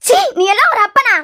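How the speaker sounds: loud, high, scornful shout; enraged contempt; disgusted outrage. Acted. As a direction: spit out the rebuke in fury. Mother disgusted with drunk son.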